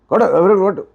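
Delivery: high, loud bully's yell; threatening shout. Acted. Thug yelling at the man to hand over the money. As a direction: scream the order at him. Skit thug's final demand.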